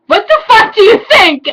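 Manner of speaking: high, loud hammed-up fury; angry outburst. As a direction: snap it out in mock outrage. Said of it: "I asked angrily".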